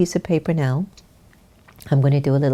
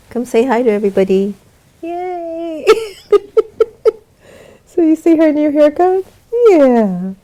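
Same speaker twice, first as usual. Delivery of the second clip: high sing-song dog talk with cries of joy; pet voice; 13 semitones higher, 7 dB louder than usual. Real, not acted. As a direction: coo at the dog excitedly. Talking to her dog.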